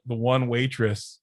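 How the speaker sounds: normal talking voice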